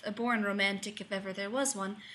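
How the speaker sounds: usual speaking voice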